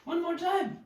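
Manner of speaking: high put-on character voice.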